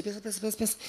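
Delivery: quiet, urgent self-talk; murmuring to himself. Retold or imitated. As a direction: mutter it under your breath, urging yourself on. Telling himself to think on stage.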